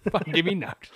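breaking into a laugh mid-quote; laughing through quote. Retold or imitated. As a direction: quote it while cracking up. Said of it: "and you're like".

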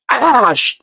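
high cut-off curse; retold yelp of pain. Storyteller re-enacts hitting his head, high mock yelp. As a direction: yelp and break off mid-swear. Retold fall onto the floor.

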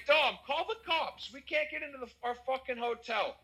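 high-pitched, exasperated retold demand; urgent demand. Man locked out of his hotel urging his brother, urgent. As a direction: act out urging someone to get help, pitch jumping up.

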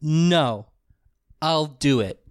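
imitated curt refusal. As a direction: act out a dismissive no, pausing between phrases. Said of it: "then this goes like".

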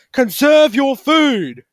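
slow, loud shout; shouted command. Real, not acted. Telling hoarders to save food, a slow loud shout. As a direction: bark it out slowly, word by word. Ranting at panic buyers.

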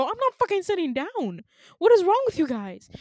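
high, loud indignant outburst; angry refusal. Retold or imitated. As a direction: protest loudly, acting out outrage. Retold angry refusal to guards.

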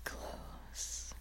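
slow teasing whisper. Real, not acted. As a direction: breathe it softly, close up.

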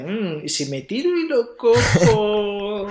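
slow, high, whimpering Frodo; a mock-tearful hobbit eyeing the ring; tempted Frodo. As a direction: wheedle it out slowly, half sobbing. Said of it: "Y dice".

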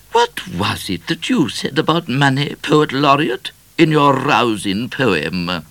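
high, loud regal question; inquiring queen. A narrator's reading. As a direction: ask grandly as a high-voiced queen.

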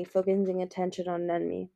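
plain reading voice

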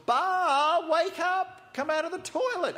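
high, loud call to wake someone; mock desperate shout. Zealots cutting themselves as they call Baal, shouting. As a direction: holler up at a god who won't answer. Acting prophets yelling to Baal.

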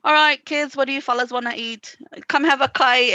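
imitated Maori accent. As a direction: do mom's Maori accent, calling kids to eat. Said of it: "your mom has an accent".

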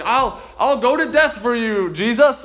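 loud, high, eager boast; bold, cocky confidence. Retold or imitated. Peter pledging himself to Jesus, bold and cocky. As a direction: declare it boldly, full of confidence. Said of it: "so bold and he's so confident".

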